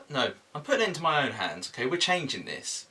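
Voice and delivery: ordinary talk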